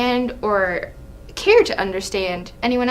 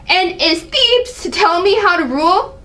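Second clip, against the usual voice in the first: indignant, pitched up in outrage; angry king; 7 semitones higher than usual. Acted. King berating his son, outraged. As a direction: bristle at being told how to rule. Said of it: "Seeing the angry side of [name]".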